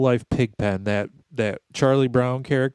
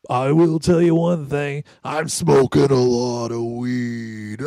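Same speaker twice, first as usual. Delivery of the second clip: stoner dude; 3 semitones higher, 5 dB louder, slower than usual. Retold or imitated. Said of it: "he ends it with".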